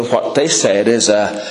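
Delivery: ordinary talk, nothing marked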